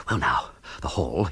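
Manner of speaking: breathless whisper to himself. Acted. Bertie whispering as he creeps to the safe. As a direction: sneak the words out unvoiced.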